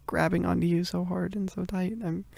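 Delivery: usual speaking voice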